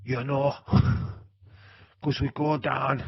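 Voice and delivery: loud, drawn-out mock Scottish; Scottish accent. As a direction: put on a big, slow Scottish accent. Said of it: "if that's a good Scottish accent".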